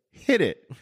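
mock showman's call; bandleader's cue. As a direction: call the band in sharply. Quoting bandleader's cue to band.

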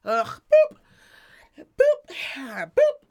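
high, loud fed-up boops; hammed-up annoyed scanning beeps; exasperated boops. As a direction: squeal each boop like a worn-out clerk. Imitating annoyed helper scanning.

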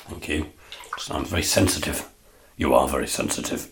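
low, soft sidekick voice; Ray's quiet voice. Acted. Sidekick Ray replying to David, low and soft. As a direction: murmur it back low.

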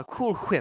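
"whip" said as "hwhip", breath before the w; mock "Cool hwhip"; exaggerated H. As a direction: overdo the h in "whip". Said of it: "pronunciation of H".

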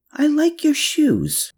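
high, slow and gentle; sweet woman's voice. A narrator's reading. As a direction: say it slowly in a high voice.

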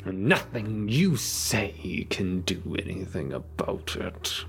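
slow vampire menace, falling away; defiant vampire villain. Acted. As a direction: dismiss them with drawn-out contempt. Game master voices vampire villain Miller.

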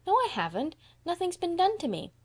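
put-on very high little-boy protest; protesting child.

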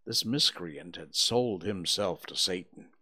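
ordinary delivery, nothing marked

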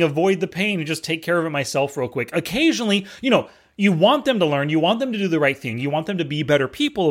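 ordinary delivery

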